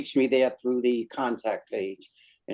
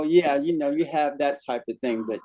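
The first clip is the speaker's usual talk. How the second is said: normal talking voice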